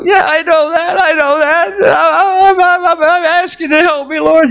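high, pleading and tearful; desperate plea. Retold or imitated. Pastor pleading with God in the bathroom, high desperate voice. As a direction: insist you already know, begging.

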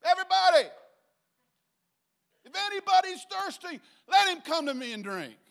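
high, full-voiced shout over a party; jumping up with a loud, high call; crying out to a crowd. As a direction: holler it so the whole feast hears. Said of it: "Yeshua jumps up and says".

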